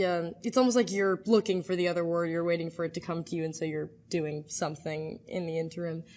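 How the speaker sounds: normal talking voice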